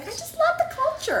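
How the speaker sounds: high, soft, mock-French gushing; affected French accent. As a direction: gush in a fake accent picked up on vacation. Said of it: "come back with an accent".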